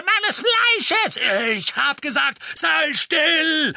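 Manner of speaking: shrieking, high hateful crone; evil queen. A narrator's reading. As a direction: scream curses like a mad old witch. Portrait screaming at Sirius.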